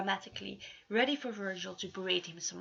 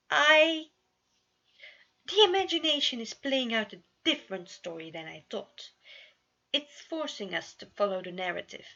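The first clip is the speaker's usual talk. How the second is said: alarmed blurt; 3 semitones higher, 8 dB louder than usual. Acted. Roman blurts out realisation.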